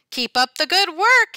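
high, loud, hearty principal; a principal beams from the doorway, loud and high; cheery principal. A narrator's reading. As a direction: cheer the teacher on from the door. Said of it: "he nods".

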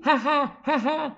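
high spoken ha-ha; playful fake chuckle; mock laugh. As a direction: tease with a put-on laugh. Teasing students over their comments.

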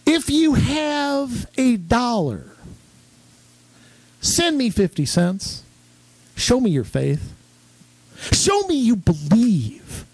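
hammed-up Southern preacher, high and slow; drawn-out televangelist pitch for money; mock revival preacher voice; Robert Tilton impression. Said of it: "He had that big Southern accent".

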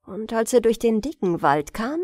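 ordinary delivery, nothing marked

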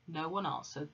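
ordinary delivery

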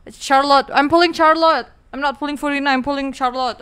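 loud, insistent announcement; proudly belting out a firm choice; emphatic declaration. Real, not acted. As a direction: declare it loudly, leaving no room for doubt. Loudly declares which character she pulls.

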